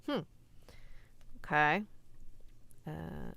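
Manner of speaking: ordinary talk, nothing marked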